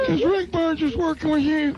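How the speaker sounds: prank-calling Ronnie in the owner's voice; Joe impression. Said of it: "do this impression so well".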